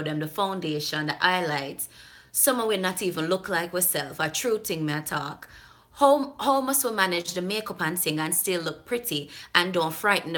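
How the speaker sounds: sustained Jamaican patois read-aloud; Jamaican accent. Acted. Vlogger repeating the viewer's whole email, Jamaican accent. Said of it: "she's writing in patois, so I'm trying to deliver it".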